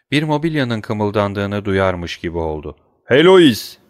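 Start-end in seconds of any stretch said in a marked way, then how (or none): 3.0-3.8 loud high call of Heloise; shout again. A narrator's reading. Husband calling his wife again through the door, loud shout. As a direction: call her name louder through the door. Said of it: "diye tekrarladı".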